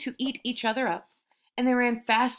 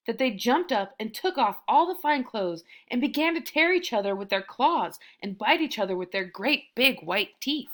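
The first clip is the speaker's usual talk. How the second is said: usual speaking voice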